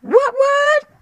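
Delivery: very high falsetto squeal; squealed catchphrase. Acted. Repeated comic catchphrase squeal.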